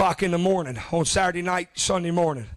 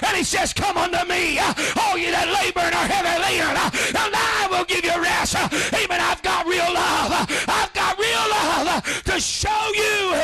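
fiery preaching; 9 semitones higher than usual. Real, not acted. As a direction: preach it hard, with a huff after each line. Preaching at full force.

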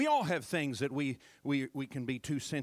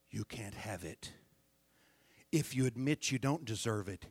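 usual speaking voice

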